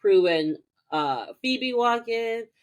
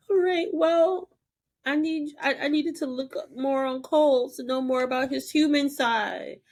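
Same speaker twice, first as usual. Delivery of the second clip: high flustered excuse; 7 semitones higher than usual. Retold or imitated. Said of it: "She's just like".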